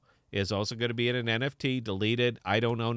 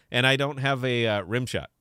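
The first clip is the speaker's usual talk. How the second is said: normal talking voice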